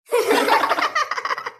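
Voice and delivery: high loud laugh; a sudden laughing fit; burst of laughter. Acted. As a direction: laugh out, caught off guard.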